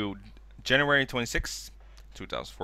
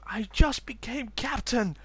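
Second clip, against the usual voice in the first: much higher, slow put-on voice; mock dismay; 11 semitones higher than usual. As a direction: whine it in a stunned high voice. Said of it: "someone's like".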